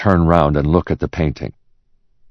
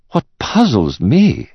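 higher, drawn-out expert voice; puzzled art expert; 9 semitones higher, slower than usual. Acted. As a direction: ponder it as a picture appraiser. Expert's lines voiced in narration.

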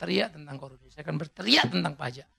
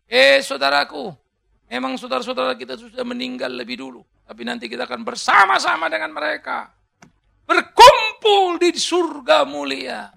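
Paul exhorting; 6 semitones higher, 11 dB louder than usual. Acted. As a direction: call out as Paul to the church. Paul voiced as preacher. (Paulus menyeru saudara-saudaranya, keras dan lambat)

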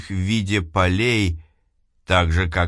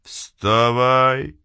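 echoing ethereal energy being; 4 semitones higher than usual. Acted. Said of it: "Потусторонний голос".

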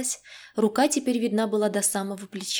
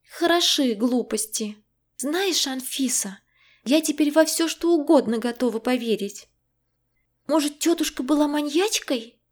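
higher, lively indignant friend; agitated friend voice; 5 semitones higher than usual. A narrator's reading. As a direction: voice the rattled friend, a little higher. Zhenya's voice in story.

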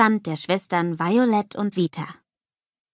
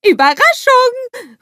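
very high, loud, drawn-out holler; excited shout; 10 dB louder, slower than usual. A narrator's reading. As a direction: shout the surprise, stretching it out high. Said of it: "ruft Olaf".

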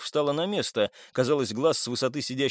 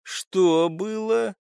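higher, drawn-out steely demand; steel in the voice; 7 semitones higher, 4 dB louder, much slower than usual. A narrator's reading. Man demands what they heard at night, steely. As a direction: ask slowly with an edge of metal. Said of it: "В голосе Поспелого лязгнул металл".

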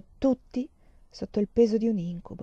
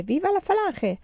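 much higher feverish shriek; the cry climbs into a shriek; delirious shriek; 11 semitones higher than usual. A narrator's reading. Feverish girl shrieking her slogan again, much higher. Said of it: "sempre gridava".

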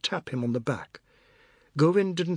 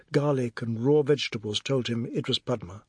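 ordinary delivery

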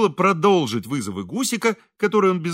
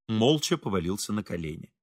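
ordinary delivery, nothing marked

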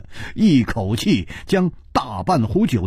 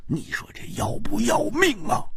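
flustered protest; 5 dB quieter than usual. A narrator's reading. Flustered friend protests the princess's teasing.

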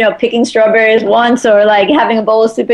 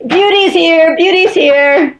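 imitated higher-pitched announcement; excited neighbors; 7 semitones higher, slower than usual. Neighbors announce grandma arriving with Friday cookies, excited higher call. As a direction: spread the happy news in a raised voice. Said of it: "everyone would be like".

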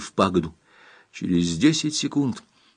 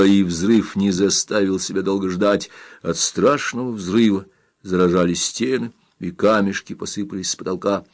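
ordinary delivery

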